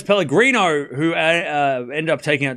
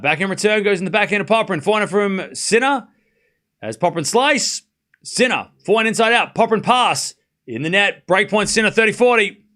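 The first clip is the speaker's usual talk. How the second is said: higher-pitched running rally call; live play-by-play; 5 semitones higher, 3 dB louder than usual. Real, not acted. Commentator follows Sinner and Popperin's rally to break point, live play-by-play. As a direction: call each shot as it lands, up to the score.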